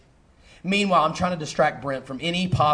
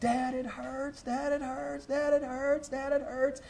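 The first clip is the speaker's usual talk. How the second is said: put-on high, soft, whimpering child's voice; imitating a crying son begging his dad; 10 semitones higher, 7 dB quieter, slower than usual. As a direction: plead like a small hurt boy near tears. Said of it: "He's crying".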